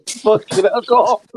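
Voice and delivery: laughing. Real, not acted. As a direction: crack up at Carl. Laughing at freaky remark.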